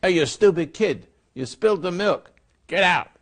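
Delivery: imitating an angry parent shouting at a child; screaming at a kid. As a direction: bark it at a kid who spilled milk. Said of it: "if somebody screams at you".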